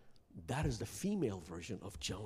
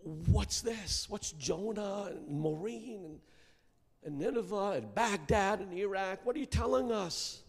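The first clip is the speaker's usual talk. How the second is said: puzzled listener; 9 semitones higher than usual. Retold or imitated. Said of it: "So you're asking me".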